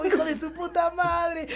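loud, high, slow laughing shout at a buddy; joyful shout. Retold or imitated. Yelling from his skateboard to a guy on a motorbike he took for a buddy, loud laughing greeting. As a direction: hail a friend across the street with a big laughing yell.

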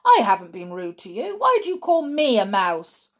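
put-on loud, fast, outraged boy; indignant shouting. A narrator's reading. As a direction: fire the questions back, offended. Bruno outraged at being called mouse.

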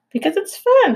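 high, eager opossum actress voice. Acted. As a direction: play a dramatic opossum giving acting advice. Opossum character voice.

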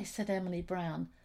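plain reading voice

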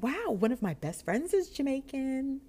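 high-pitched, gushing attempt to bond; over-eager small talk. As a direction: gush with put-on enthusiasm. Overeager attempt to befriend clerk.

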